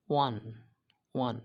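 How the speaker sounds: "ওয়ান" said alone with an open "ওয়া" start; stressed "ওয়া" sound. Shown as a demonstration. Teacher showing how the vowel sounds, "ওয়ান" starting on "ওয়া". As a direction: set "ওয়ান" apart, letting "ওয়া" ring. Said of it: "উচ্চারণ কিসের মতো হয়েছে".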